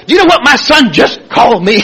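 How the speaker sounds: high-pitched, worked-up tattling; indignant complaint. Retold or imitated. Father telling his sponsor about his son, mock indignation. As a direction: imitate an outraged caller reporting an insult.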